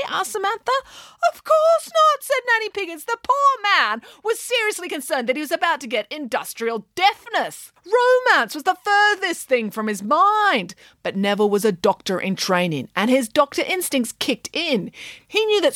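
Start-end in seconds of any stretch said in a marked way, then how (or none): 1.3-10.8 high, indignant storyteller pig; scoff, then explain grandly; Nanny Piggins voice. Acted.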